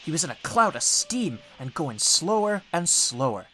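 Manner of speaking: plain reading voice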